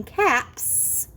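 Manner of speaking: "caps" with a long hissed ending; final S sound. Shown as a demonstration. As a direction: hold the S of "caps". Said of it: "can make the S sound".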